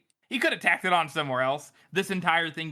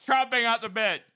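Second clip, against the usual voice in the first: high, louder hammed-up exclamation; mock officer's cry; 6 semitones higher, 4 dB louder than usual. Reading a meme comment to co-hosts, mock-delighted officer. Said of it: "one of them is".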